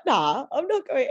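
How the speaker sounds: a hint of a lazy refusal, slowed down; Chilean friend. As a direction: friend lightly voiced refusing to go. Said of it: "this beautiful Chilean man from Chile".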